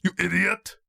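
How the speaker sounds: loud, high put-on movie shout; film hero's outburst. Podcast host quoting the film hero, angry mock shout. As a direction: bellow it like an action hero. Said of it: "what he says to the horse".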